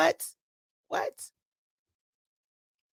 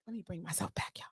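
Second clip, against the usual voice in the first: whispered, much softer; calming herself; 3 semitones lower, 17 dB quieter than usual. Real, not acted. After ranting at her viewers, whispering herself back to calm. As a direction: pull yourself back down to calm. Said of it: "let me take that back".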